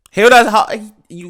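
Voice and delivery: sudden loud high outburst; loud outraged call. Real, not acted. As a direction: jump up loud and high, demanding they notice. Pointing out a sexist trailer line.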